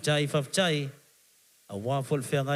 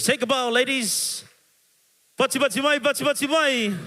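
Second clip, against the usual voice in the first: hype call; 6 semitones higher, 4 dB louder than usual. Real, not acted. MC calls for applause.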